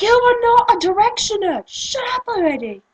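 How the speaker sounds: high, loud indignant shriek; mock outraged scolding. Ranter to fake fans, high mock outrage. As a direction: tell them off at full pitch.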